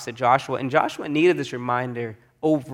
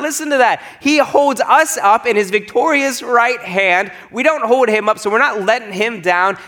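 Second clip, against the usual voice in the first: raised, high-pitched preaching; insistent pulpit voice, well raised; emphatic preaching; 7 semitones higher, 4 dB louder than usual. Real, not acted. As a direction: press the point home urgently. Preacher presses the point home.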